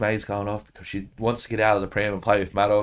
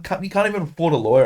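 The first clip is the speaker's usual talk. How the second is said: fast, louder and higher scornful comeback; contemptuous retort; 8 semitones higher, 4 dB louder, faster than usual. Retold or imitated. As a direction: cut him down fast and scornfully. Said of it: "I'm like".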